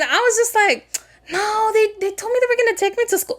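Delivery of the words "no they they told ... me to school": high, rushed, naive excuse; acted high-pitched naive self; naive protest. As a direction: protest innocently in a raised pitch, hurrying. Acted naive reply to worried friend.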